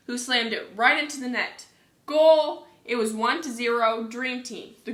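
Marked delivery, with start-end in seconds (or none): 2.1-2.7 loud, high exclamation; excited storybook shout; goal cheer. A narrator's reading. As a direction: call out the goal.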